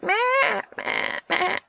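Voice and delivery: high thin bleating meh; lamb bleat imitation.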